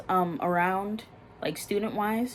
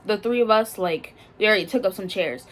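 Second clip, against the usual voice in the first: ordinary talk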